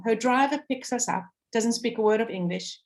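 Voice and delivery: normal talking voice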